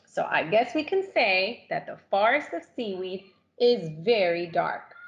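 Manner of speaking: usual speaking voice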